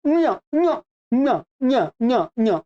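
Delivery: "न्य" as short, separate palatal-nasal syllables; palatal nasal demo. Shown as a demonstration. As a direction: repeat "न्य" with tongue at the palate, sound through the nose. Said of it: "इसका उच्चारण क्या होगा". ("न्य" का तालव्य नासिक्य उच्चारण)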